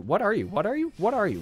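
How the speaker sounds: usual speaking voice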